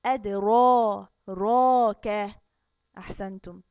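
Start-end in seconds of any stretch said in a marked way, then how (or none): 0.1-2.4 أدرا، راك with a thick, full-mouthed ر, slow and louder; heavy ra. Shown as a demonstration. Teacher showing kids the heavy ra, tafkheem. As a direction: make the ر in راك fat and heavy. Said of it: "هنخليه تخين ونقول".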